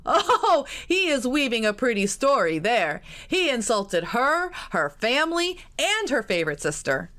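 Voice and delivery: loud, high words through laughter; mocking cousin cackling as he tells it; laughing. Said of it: "Richard howled with laughter".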